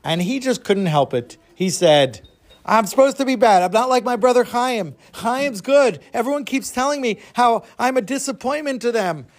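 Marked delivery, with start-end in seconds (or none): 2.7-9.3 put-on a higher, petulant kid voice for Moshe; defiant boy. Naughty younger brother insisting to himself, higher defiant child voice. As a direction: play the bad kid sulking out his excuse.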